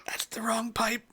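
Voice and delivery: whispered aside. Real, not acted. Game master choking on a drink, whispered aside. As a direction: say it under your breath.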